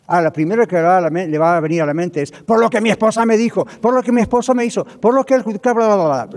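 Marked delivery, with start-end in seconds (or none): 2.3-6.4 high, loud blaming rant; worked-up complaining. Acted. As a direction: rattle off grievances, spilling into blah-blah. Quejas que vienen a la mente. (queja alterada, aguda y fuerte)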